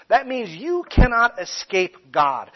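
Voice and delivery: normal talking voice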